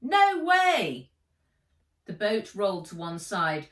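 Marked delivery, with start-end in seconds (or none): 0.0-1.1 high, loud defiant no; shouted refusal. Acted. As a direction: throw the refusal back at her. Saskia refuses, shouting in storm.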